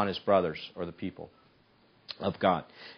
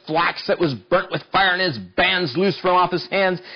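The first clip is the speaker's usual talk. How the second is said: rising, louder preaching read of the verse; scripture reading; 7 semitones higher, 5 dB louder than usual. Acted. Preacher reads Samson's victory to the congregation, building.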